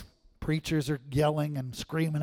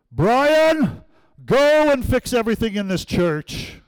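high, very loud commanding proclamation; booming God voice; 7 semitones higher, 10 dB louder, slower than usual. Acted. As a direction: thunder an order down from heaven, hammed up. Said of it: "I think we expect this".

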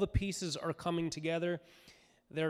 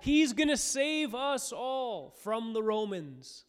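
much higher and louder, jubilant; excited crowd shout; 10 semitones higher, 7 dB louder than usual. Retold or imitated. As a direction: cheer the arriving king. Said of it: "they're shouting".

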